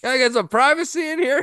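high put-on voice, acting interrupted; mock bathroom occupant. Cohost plays someone disturbed in the bathroom, high mock voice. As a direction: squeak it out like someone caught on the toilet.

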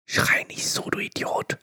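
angry whisper; whispered bickering. Pretend. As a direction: argue in a whisper. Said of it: "Aber flüsternd".

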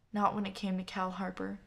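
plain reading voice

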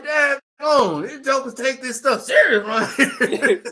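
high, laughing shout of disbelief; amazed laughing shout. Retold or imitated. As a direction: laugh it out, stunned they took it seriously. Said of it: "I'm like".

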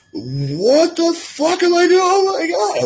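high, drawn-out wail of horror; crying freak-out. Retold or imitated. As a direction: re-enact a panicked, tearful outburst. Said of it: "I was freaking out. I was crying".

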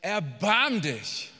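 slow, drawn-out loud plea; cry for mercy. Acted. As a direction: stretch the plea into a swelling cry. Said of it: "hat nur diese eine Sache gesagt". (gedehnter, flehender Ruf)

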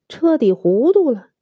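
plain reading voice

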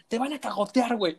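high, teasing warning; mock warning. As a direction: tease him about the scolding ahead. Friend heard in voice note. (advertencia burlona)

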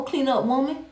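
ordinary talk

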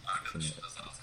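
faint whispery echo, far off; like an echo in a canyon. Real, not acted. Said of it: "Bayağı yankı yapıyor".

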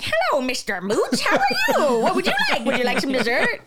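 high, loud, laughing sing-song waitress; mock chirpy server voice.

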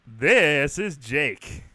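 THIS hugely stretched and loud; slow, booming self-introduction; grand presentation. Shown as a demonstration. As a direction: announce yourself with a big drawn-out flourish. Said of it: "just kinda hovering over it and go".

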